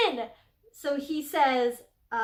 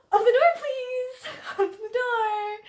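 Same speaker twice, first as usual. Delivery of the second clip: high, loud mock plea; dramatic lover begging her to open the door; 10 semitones higher, 5 dB louder than usual. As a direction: whine like a suitor who won't take no. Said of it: "he immediately turns around".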